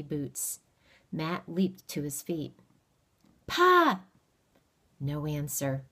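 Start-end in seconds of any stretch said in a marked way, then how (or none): 3.5-4.0 high, loud call across the clearing. Acted. Boy calls toward the trees for his father, loud hopeful call. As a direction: shout for your father, hopeful.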